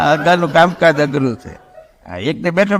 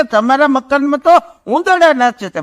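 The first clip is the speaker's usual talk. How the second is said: higher, louder repeat of the line; repeated louder for emphasis; 7 semitones higher than usual. Shown as a demonstration. Tenant repeating the complaint to the landlord, pitched up. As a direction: say it again, pitched up and pointed. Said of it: "હે?".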